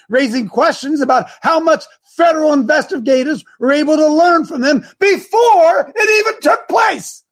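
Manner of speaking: rushed, rising into a shout; all in one breath. Real, not acted. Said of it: "I'm talking so fast here".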